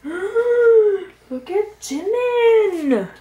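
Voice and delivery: very high, loud, drawn-out squeal; overwhelmed with delight; fangirl squeal. Real, not acted. Fan squealing at a photo of [name].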